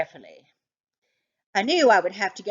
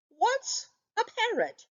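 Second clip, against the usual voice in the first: high, baffled question; puzzled dog asking what a parrot is. A narrator's reading. Harold asks Toby, baffled by the word, high puzzled question.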